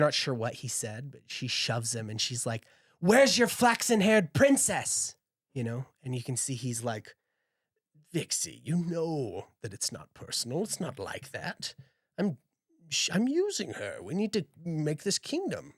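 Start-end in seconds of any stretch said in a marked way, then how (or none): 3.0-5.1 high, loud and slow, hurt and furious; jealous outburst. Acted. Jealous Silva lashing out at Marcus, shrill and loud. 8.1-15.7 a touch of pleading, climbing higher as he justifies; pleading Marcus. Acted. Marcus pleading with jealous Silva, rising higher.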